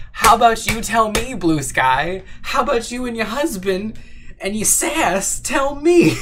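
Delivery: high, loud sassy comeback; mock sassy outrage. Playful mock outrage at chat.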